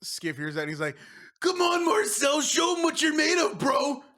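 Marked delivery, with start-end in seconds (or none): 1.4-4.0 high shouted hype, slow on the name; cheering on. Acted. Skiff urging Marcel to perform, shouted hype. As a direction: egg your buddy on across the bar.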